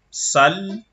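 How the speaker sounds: "Sal" with the l doubled and held; shadda on sal. Shown as a demonstration. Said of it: "on dit sal à cause du shadda".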